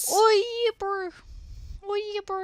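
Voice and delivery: high-pitched mock New Zealand accent. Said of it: "the only way I can do a New Zealand accent".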